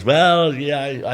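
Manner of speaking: high, loud drawn-in "well"; retold exclamation. TV boss reconsidering the driver after his comedy turn, mock exclamation. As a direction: start high and loud, then ease down.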